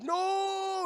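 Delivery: high shouted no; hammed-up grief; anguished no. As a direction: cry out in grief. Voicing Doctor Seuss grieving.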